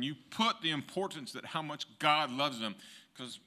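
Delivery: loud, high, fervent preaching; preaching at full force. Real, not acted. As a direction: preach it full voice, lifting the room. Testimony rising into full preaching.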